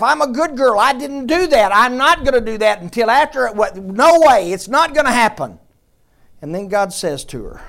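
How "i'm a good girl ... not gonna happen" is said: loud, high indignant protest; Mary's loud offended denial; defending her honor. Retold or imitated. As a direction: insist you're a good girl. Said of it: "She defends herself".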